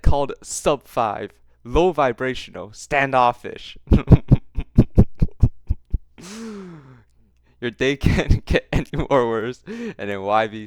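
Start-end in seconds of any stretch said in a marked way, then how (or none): 3.8-6.8 loud laugh. Real, not acted. Laughing at his own recap.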